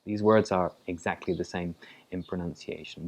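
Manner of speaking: normal talking voice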